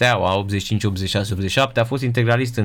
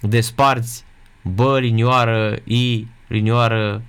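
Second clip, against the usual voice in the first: spelling out "bă, linioară, i" slowly, syllable by syllable; slowly marking each hyphen as "linioară"; slow syllable demo; slower than usual. Shown as a demonstration. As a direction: dictate the split slowly to students. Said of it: "Deci tu nu poți să".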